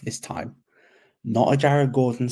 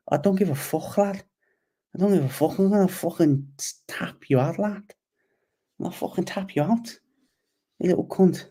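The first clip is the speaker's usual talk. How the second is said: higher, mock-aggressive Scouse taunt; heavy Liverpool Scouse accent, pitched up, goading; Paddy's Scouse accent; 7 semitones higher than usual. Paddy taunting Bobby Green before the fight, in a higher mock Scouse accent. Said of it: "the thick Scouse accent".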